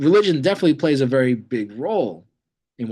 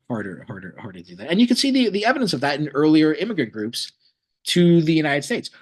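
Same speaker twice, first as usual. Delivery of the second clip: ordinary talk, nothing marked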